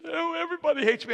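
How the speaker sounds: high, whiny insistence; mock whining. Friend contradicting his pastor in the office, imitated whining. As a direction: imitate a self-pitying man who won't be consoled. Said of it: "he's kinda whining".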